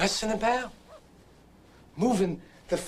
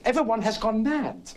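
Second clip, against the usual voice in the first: disbelieving outburst; 7 semitones higher than usual. Retold or imitated. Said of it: "I said".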